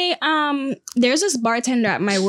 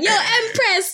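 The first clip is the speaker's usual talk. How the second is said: high, laughing imitation of a hearty hail; bartender's greeting; 12 semitones higher, slower than usual. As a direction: call out playfully, pitched way up. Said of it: "He'd be like".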